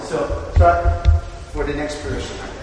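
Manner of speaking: ordinary talk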